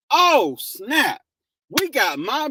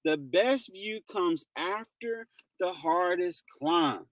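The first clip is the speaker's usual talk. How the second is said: slow, softer, letting "The best view comes after the hardest climb." sink in; savoring the quote; 3 semitones lower, 7 dB quieter, slower than usual. Shown as a demonstration. Streamer savors a viewer's favorite quote, slow and soft. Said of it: "I like that".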